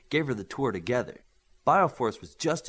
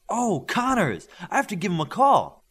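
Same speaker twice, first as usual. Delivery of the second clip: bright sudden burst, louder and higher; excited; 8 semitones higher, 8 dB louder than usual. Acted. Girl suddenly remembering to call Connors, excited burst. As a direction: blurt it out as the idea hits.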